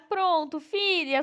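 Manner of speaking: ordinary delivery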